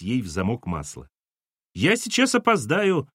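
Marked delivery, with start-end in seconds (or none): 1.7-3.1 high urgent complaint; calling out. A narrator's reading. Son calling from the car, high and urgent. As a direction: hurry them from the car. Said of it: "поддал жару Тим".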